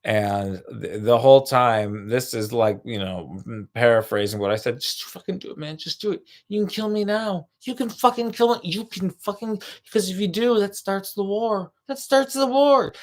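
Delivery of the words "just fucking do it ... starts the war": high, pleading mock egging-on; taunting guy voice. Mocking the man daring the stranger to shoot. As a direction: goad him to shoot, pitched up high.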